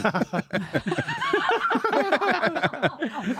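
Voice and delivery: long high laugh; real laughing fit; high burst of laughter. Real, not acted. Big laugh.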